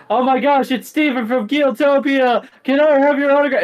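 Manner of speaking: very high, loud squealing fan; starstruck fan. Acted. Fan spotting Steven from Geotopia, starstruck squeal. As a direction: shriek it like an excited fan, rushing at the end. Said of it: "Steven, you hear".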